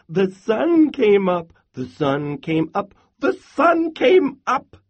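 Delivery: high, loud happy chirp; chirping squirrel. A narrator's reading. Happiest little squirrel celebrates the sunrise, chirping high. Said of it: "he chirped".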